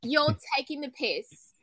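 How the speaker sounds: slow, exaggerated Love Island British accent. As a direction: draw it out like a Love Island contestant. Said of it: "if I was on Love Island".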